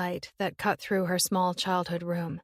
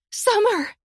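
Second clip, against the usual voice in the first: much higher and louder scream; 8 dB louder than usual. Acted. Willow screaming her sister's name after the line dies. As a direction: rip it from your lungs. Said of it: "voice ripping from somewhere deep in her lungs".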